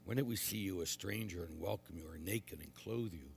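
measured, solemn proclamation; quiet liturgical reading; gospel reading. Acted. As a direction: read scripture aloud at the altar.

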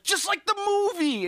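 excited high-pitched exclamation; Chris Pratt. Acted. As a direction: blurt it like an eager movie fan. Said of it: "Chris Pratt, of course, is just like".

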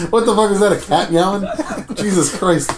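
high-pitched, laughing disbelief; can barely talk for laughing; real laughing. Real, not acted. Host cracking up at a weird noise, laughing hard.

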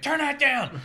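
very high, loud retold yell; angry parental shout. Strict household banging on the door over the radio, mock furious shout. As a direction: imitate someone yelling through a bedroom door. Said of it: "someone banging on my door".

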